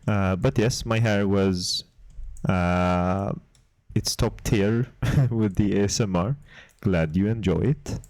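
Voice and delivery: badly faked Swedish accent, close on the mic; casual chat in a put-on Swedish accent; terrible Swedish accent. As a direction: keep up the mock Swedish accent for ASMR listeners. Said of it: "like my terrible Swedish accent".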